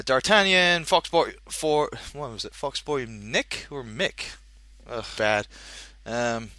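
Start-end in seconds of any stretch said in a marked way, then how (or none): none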